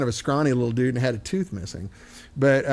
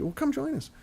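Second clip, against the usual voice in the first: very high, soft friendly imitation; inviting northern local; 12 semitones higher, 8 dB quieter than usual. Northerner inviting the American along, high friendly imitation. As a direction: invite them in a high, light voice. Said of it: "And people would say".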